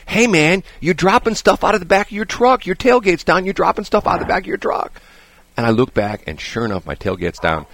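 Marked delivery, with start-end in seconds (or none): 0.1-5.0 raised, higher voice calling across from the next car; quoting a stranger. Retold or imitated. Stranger at a stoplight warning him, called out higher. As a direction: call out the warning like the other driver. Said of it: "and the guy says".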